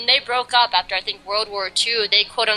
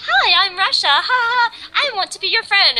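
put-on very high, sweet childlike character voice; Russia; 11 semitones higher than usual. Fan acting out Russia being kind and sweet, high sugary voice. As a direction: play sweet and innocent with a giggly ha-ha-ha. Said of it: "so kind and sweet".

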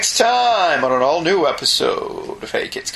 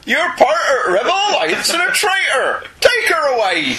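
put-on Darth Vader in a broad Northern English accent, pitched higher; 9 semitones higher than usual. Co-host plays Darth Vader with a Northern accent. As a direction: bark orders like a Northern farmer Vader. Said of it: "Darth Vader".

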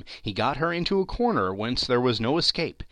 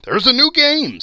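loud, much higher eager plea; eager begging; 13 semitones higher, 10 dB louder than usual. A narrator's reading. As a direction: beg like an excited boy. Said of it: "he begged like a boy".

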